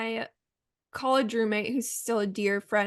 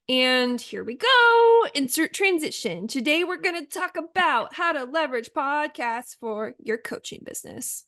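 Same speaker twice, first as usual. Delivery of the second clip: exaggerated, lifted podcast announcer voice; mock podcast-host intro; 4 semitones higher, 3 dB louder than usual. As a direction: ham up the segue into today's topic. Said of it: "we make them with our voice".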